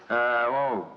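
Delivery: quick Brummie accent demo; put-on Brummie; Birmingham accent. Shown as a demonstration. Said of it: "then with Birmingham accents".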